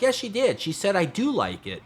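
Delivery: high-pitched mock insistence; imitating insistent mom. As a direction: argue back as an excited mother. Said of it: "My mom's like".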